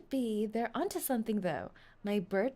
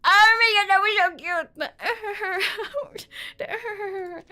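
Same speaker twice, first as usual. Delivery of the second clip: loud squeal; 7 semitones higher, 8 dB louder, slower than usual. Real, not acted. Squealing at cute sounds.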